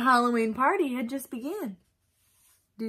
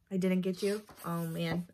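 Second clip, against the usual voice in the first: plain reading voice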